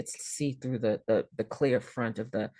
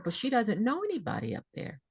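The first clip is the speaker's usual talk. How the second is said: put-on higher, small childlike plea; a lightly played little girl begging; 8 semitones higher, 3 dB quieter, faster than usual. Daughter begging the nurse in a childlike voice. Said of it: "I sounded like I was seven or eight".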